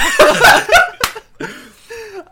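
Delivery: big, high burst of laughing; loud high laughter. Real, not acted. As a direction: crack up loudly. Player laughs at the answer.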